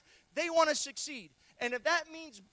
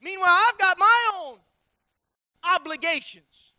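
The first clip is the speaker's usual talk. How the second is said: louder, higher repeated refrain; preaching build; 3 semitones higher, 7 dB louder than usual. Acted. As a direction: climb with each meanwhile.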